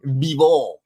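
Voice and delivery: name said in a mock Russian accent; a quick joking Russian-accented Bivol. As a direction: Bivol with a playful Russian twist. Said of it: "That's my Russian accent".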